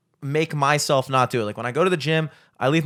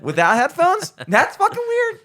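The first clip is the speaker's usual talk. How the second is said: higher-pitched, mounting disbelief; incredulous onlooker; 7 semitones higher, 4 dB louder than usual. Acted. Onlooker judging the man mumbling without headphones, incredulous. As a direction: build to a high, louder punch of judgement. Voicing an onlooker's judgement of himself.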